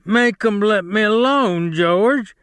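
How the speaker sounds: terrified plea. Acted. Lennie being beaten, begging George in a high terrified voice. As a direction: beg George to save you. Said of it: "he cried".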